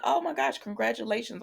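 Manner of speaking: high-pitched congratulations, lightly acted; retold delight. Johnny congratulating the engaged couple, mildly delighted. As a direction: gush a little, imitating a happy well-wisher.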